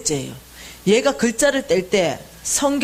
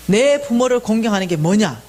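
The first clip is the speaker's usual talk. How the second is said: ordinary talk, nothing marked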